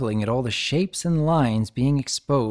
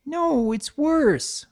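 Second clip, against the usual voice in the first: slow, high whining; filly whining over a muddy book; whiny; much slower than usual. A narrator's reading. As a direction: drag it out in a whine. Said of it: "Sweetie whined".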